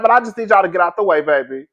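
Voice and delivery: normal talking voice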